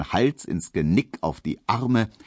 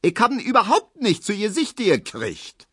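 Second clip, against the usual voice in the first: put-on very high, screeching old woman's protest; shrieking protest; 14 semitones higher than usual. A narrator's reading. Grandmother protesting the blame, screeching. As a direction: scream denial like an outraged granny. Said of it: "zetert Frau Neuendorf".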